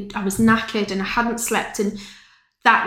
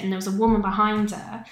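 usual speaking voice

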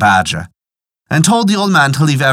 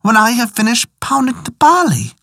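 lightly voiced pitiful badger, higher pitch; pleading badger; 6 semitones higher than usual. A narrator's reading. Tied-up badger begs old woman to let him down, pleading. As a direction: plead wearily in a slightly raised animal voice.

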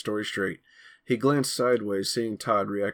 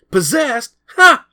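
much higher, louder mocking laugh; scornful snort; 15 dB louder, slower than usual. Greg mocking Billy's demon panther, loud scornful snort. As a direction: scoff loudly at the idea. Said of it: "Greg snorted".

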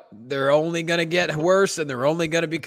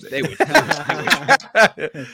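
laughing taunt; 3 semitones higher, 6 dB louder, much slower than usual. Acted. Taunting chant.

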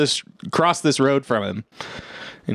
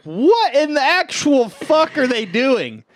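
stunned disbelief; 11 semitones higher, slower than usual. Retold or imitated. Plane attacking Roger.

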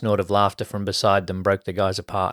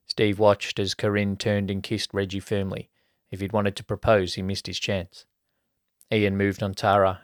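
usual speaking voice

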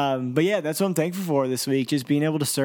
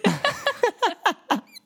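high laughter. Real, not acted. Laughs after singing.

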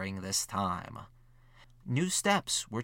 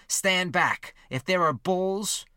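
higher, louder quoted challenge; raised-voice warning; 8 semitones higher, 6 dB louder, slower than usual. A narrator's reading. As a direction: read the quote out firmly, pitched up and louder. Narrator quotes Everest warning pursuers.

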